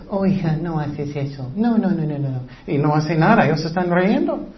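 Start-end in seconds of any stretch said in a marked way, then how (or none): 0.1-2.4 softer, limp half-hearted scolding; a hint of a pushover parent who won't follow through; lax parent. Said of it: "piensan que castigo es".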